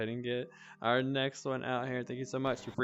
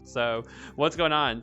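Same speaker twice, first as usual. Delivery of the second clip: announcer call; 5 semitones higher, 8 dB louder, slower than usual. Real, not acted. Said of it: "people asking in the chat".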